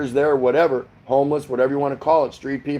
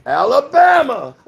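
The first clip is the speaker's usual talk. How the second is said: high, loud, drawn-out Forrest Gump shout; 12 semitones higher, 7 dB louder, slower than usual. Retold or imitated. Host doing Forrest Gump for a viewer from Alabama, loud and slow. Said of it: "do my Forrest Gump impression".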